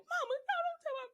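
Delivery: high, pleading voice; pleading mom. Retold or imitated. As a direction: beg grandma to stop. Voicing mother, retold.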